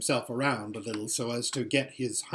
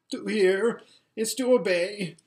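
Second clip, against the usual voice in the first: high, loud, put-upon moan; moaning vizier; 7 semitones higher, 5 dB louder than usual. Acted. Vizier to the Tisroc, wretched high moan. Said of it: "moaned the wretched, wretched man".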